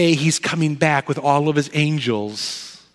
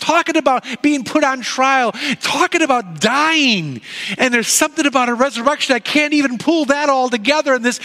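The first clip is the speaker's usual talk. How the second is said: higher, quicker voice of a nervous Peter pleading innocence; Peter, anxious and defensive; 9 semitones higher than usual. Acted. Peter to the courtyard crowd, denying he knows Jesus, nervous and higher. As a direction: play Peter insisting he's just a bystander, pitched up.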